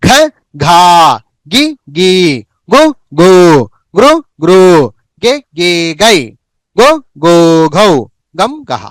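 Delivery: short vowel then stretched vowel, from ఘ to గహ; syllable chart recitation. Shown as a demonstration. Teacher showing how to recite ఘా గుణింతము, measured chanting. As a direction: recite ఘ, ఘా in short-long pairs. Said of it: "ఘా గుణింతము ఎలా పలకాలి?".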